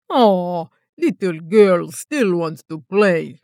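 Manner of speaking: a hint of a mocking Russian accent; taunting Russian accent. A narrator's reading. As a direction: taunt her in a Russian accent like a cat with a mouse. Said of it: "The hunter taunted in his returned accent".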